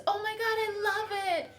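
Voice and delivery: high gushing praise; gushing American. Retold or imitated. Wife imitating Americans praising her hair. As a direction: gush over the new hair.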